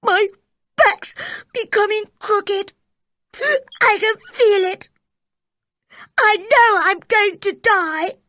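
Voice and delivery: high, tearful wail; frightened boy wailing through tears; crying. A narrator's reading. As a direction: sob it out in despair. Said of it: "he went on crying".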